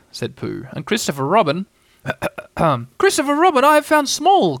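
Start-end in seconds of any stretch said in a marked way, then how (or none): 3.0-4.6 very high, loud squeak; high-pitched triumphant little cry; excited cry. A narrator's reading. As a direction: announce it in a tiny, thrilled voice. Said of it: "cried Piglet".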